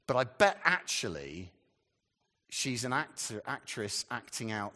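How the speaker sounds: ordinary talk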